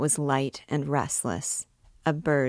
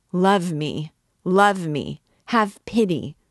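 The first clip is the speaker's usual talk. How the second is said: louder, higher pleading that builds; anguished cry; 3 semitones higher, 6 dB louder than usual. A narrator's reading. The priest clasping Esmeralda, anguished cry. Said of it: "cried the poor priest".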